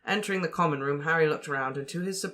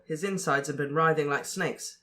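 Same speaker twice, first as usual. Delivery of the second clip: ordinary delivery, nothing marked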